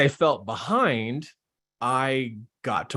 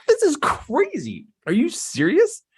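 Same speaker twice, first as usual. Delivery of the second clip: amazed disbelief; 4 dB louder than usual. Retold or imitated. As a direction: play up the shock, way up high. Acting his own amazement.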